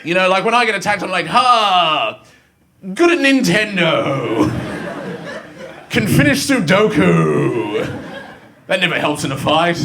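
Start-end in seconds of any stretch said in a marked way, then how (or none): 1.3-7.8 lightly voiced superhero, slow and drawn out; mock superhero cry. Nerd facing an attacker, mock superhero cry. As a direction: announce feeble powers like a comic-book hero.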